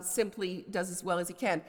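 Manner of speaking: ordinary talk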